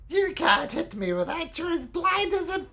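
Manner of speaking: very high jeering creature voice; taunting goatling. Acted. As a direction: jeer in a squeal that gets cut off. Game master voices goatling taunting foe.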